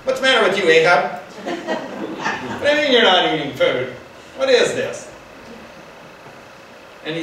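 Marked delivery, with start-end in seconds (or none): 0.0-5.1 higher, louder mock scold, half laughing; scolding wife. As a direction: nag him loudly like an exasperated wife. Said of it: "Can't you just hear that?".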